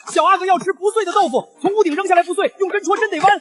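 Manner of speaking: palace eunuch. Acted. Eunuch relays the prince's order.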